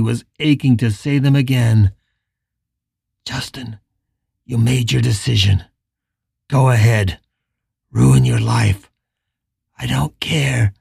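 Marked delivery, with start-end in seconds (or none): 3.2-8.9 low, cold and dismissive; cold rejecting man. A narrator's reading. Richard's remembered cruel words. 9.8-10.7 whispered, icy dismissal; cold whisper. A narrator's reading. Cruel remembered words.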